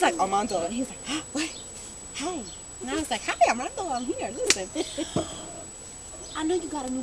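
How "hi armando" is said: ordinary delivery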